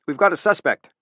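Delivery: ordinary delivery, nothing marked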